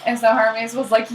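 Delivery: ordinary talk, nothing marked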